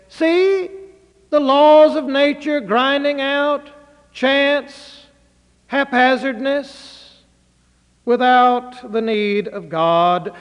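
slow scornful reading; sceptical reading. Shown as a demonstration. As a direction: read it like a cold sceptic. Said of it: "you can read it either way".

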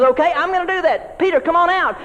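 lightly voiced God granting the prayer, high; God answering. God answering the praying church, lightly voiced high.

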